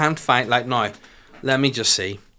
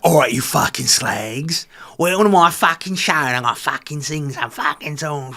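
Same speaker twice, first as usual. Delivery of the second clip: [name]'s rough talking voice; 4 semitones higher, 5 dB louder than usual. Retold or imitated. Mocking [name] as she greets her concert crowd, loud and crude. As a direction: bellow it like a foul-mouthed diva taking the stage. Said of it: "And her talking voice, horrendous".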